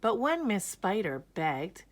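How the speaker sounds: plain reading voice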